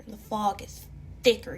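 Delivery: plain reading voice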